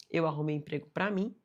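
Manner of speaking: ordinary delivery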